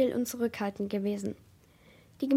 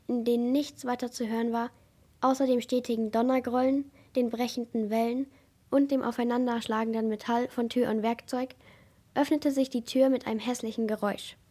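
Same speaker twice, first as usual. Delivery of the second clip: plain reading voice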